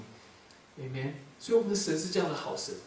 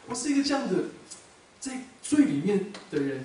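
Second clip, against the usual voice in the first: raised, impassioned testimony building louder; preaching at full force; 5 semitones higher, 6 dB louder than usual. Acted. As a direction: preach it to the congregation, voice lifted.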